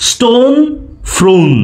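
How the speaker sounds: Stone held high and rising, ফ্রোন answering with a fall; stress on rhyme sound. Shown as a demonstration. Teacher compares end sounds for students, stone rising, ফ্রোন falling. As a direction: stretch the vowels of stone and ফ্রোন to match. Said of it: "sound আসতেছে না".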